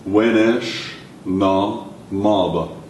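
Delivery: WENESH, NA, MABA very slow with gaps; word by word. Shown as a demonstration. Teacher modelling a question for learners, word by word. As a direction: spell out "Wenesh na maba" one word at a time.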